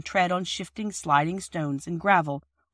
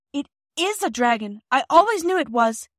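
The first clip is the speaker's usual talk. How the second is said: high, loud frightened shout; a terrified girl shouting about a dragon; 8 semitones higher, 5 dB louder than usual. A narrator's reading. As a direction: scream it over the train. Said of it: "shouted Phyllis".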